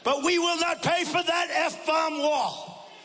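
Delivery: high, slow, shouted cursing mimicry; imitating Fox cursing about the wall; mock raging Vicente Fox. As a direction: yell it like he's losing it on air. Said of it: "But he was going crazy".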